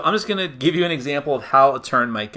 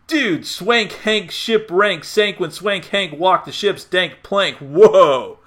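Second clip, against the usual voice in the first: slow, higher laid-back surfer dude; gnarly surfer; 5 semitones higher, much slower than usual. Shown as a demonstration. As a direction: drawl it like a chill surfer. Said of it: "talk like a gnarly surfer".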